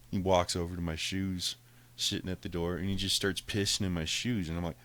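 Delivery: usual speaking voice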